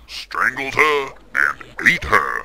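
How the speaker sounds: a gorilla muttering an accusation. Pretend. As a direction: drag it out like a talking gorilla. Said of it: "as my esteemed simian colleague suggests".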